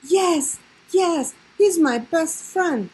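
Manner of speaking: high, slow happy cry; shouted. A narrator's reading. Lisa finding her lost bear, joyful high shout. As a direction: call out with joy while running over. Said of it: "shouted Lisa as she came running".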